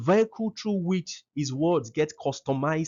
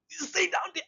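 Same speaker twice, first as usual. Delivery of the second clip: high, sharp raised command. Shown as a demonstration. Father demonstrating a raised voice to a child. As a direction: raise the voice to order them down. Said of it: "If I raise it now".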